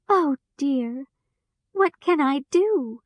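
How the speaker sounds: high, upset near-tearful voice; a touch of tears, pleading; crying Dorothy. A narrator's reading. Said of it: "Dorothy began to cry".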